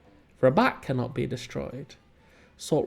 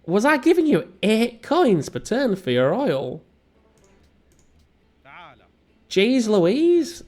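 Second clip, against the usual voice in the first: mock outrage; 7 semitones higher, 3 dB louder, slower than usual. Mock outrage at AI trade offer.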